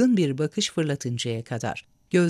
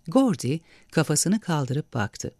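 ordinary delivery

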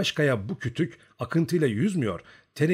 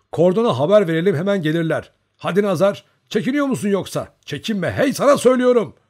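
high, loud, impatient orders; pressing, raised-voice command; urgent urging; 9 semitones higher, 9 dB louder than usual. A narrator's reading. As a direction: hurry someone along at full voice. Urging comrade to go for help.

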